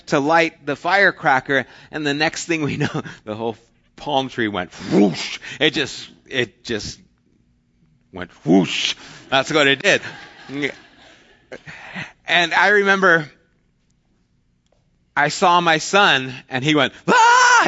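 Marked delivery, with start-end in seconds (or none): 4.0-5.4 slow, drawn-out whoosh as the palm tree goes up; whoosh sound effect. Retold or imitated. Firecracker sets tree ablaze. 8.1-8.9 a quicker whoosh like a burst of fire; punchy mimicked whoosh of flames; whoosh sound again. Repeats the tree catching fire. 17.1-17.7 high, loud startled scream; son's scream. Retold or imitated. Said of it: "He screamed and went running".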